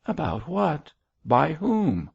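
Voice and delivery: high, swooping questions; lightly incredulous, pitched well up; surprised questioning. A narrator's reading. George quizzes Mab about his worried father, surprised.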